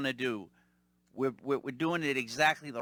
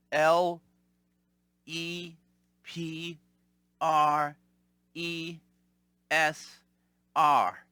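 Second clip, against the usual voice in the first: spelling it out; much slower than usual. Retold or imitated. Telemarketer spelling the name letter by letter. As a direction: spell out each letter separately. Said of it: "So she goes".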